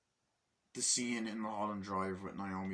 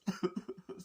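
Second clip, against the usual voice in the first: mock laugh-cry; 13 semitones higher than usual. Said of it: "she starts crying".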